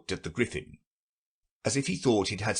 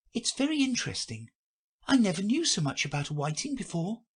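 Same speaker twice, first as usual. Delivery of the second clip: put-on high, light girl voice; a polite young girl, curious; Alice's voice; 12 semitones higher than usual. A narrator's reading. Alice thanks the Gryphon for the whiting facts, girlish high voice.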